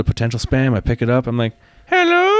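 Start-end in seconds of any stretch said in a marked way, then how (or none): 1.9-2.3 sudden very high, louder falsetto hello; weird put-on voice. Answering a spam call in a fake high voice. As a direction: squeak out a fake high hello to dodge the caller. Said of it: "doing, like, this weird voice".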